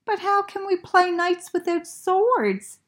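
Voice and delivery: high, puzzled friend's voice; disappointed Bear. Acted. Bear asks Franklin how to play, high disappointed voice. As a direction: ask it let down, up high. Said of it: "Bear was disappointed".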